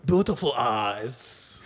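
mock French accent drifting toward Japanese; slow fake French accent, badly done; a bad accent that slides off French; failed French accent. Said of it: "that sounded a little like Japanese now".

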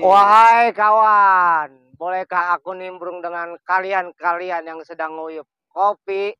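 put-on slow, grand old-style address; slow formal storyteller's greeting; theatrical greeting. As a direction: make a pompous, stately entrance. Theatrical entrance of new character.

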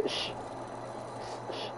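soft voiceless "sh" hisses; short, separate "sh" noises; hushing S. Shown as a demonstration. Showing the S of Spain's accent, hushing hiss. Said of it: "Así como que".

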